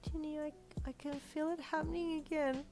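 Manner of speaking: put-on high, halting child's voice; small, frightened little boy; panicking. A narrator's reading. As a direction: stammer with rising worry. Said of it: "he said, panicking".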